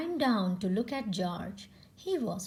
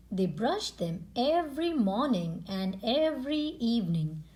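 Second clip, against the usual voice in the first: ordinary delivery, nothing marked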